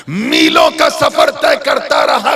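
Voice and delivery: high, rising sermon delivery; impassioned preaching. A narrator's reading. Impassioned preacher telling a story. (जोशीला, ऊँचे सुर का बयान)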